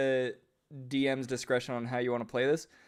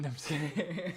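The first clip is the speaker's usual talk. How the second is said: laughing; 4 semitones higher, 7 dB quieter than usual. Real, not acted. Jokes, then laughs.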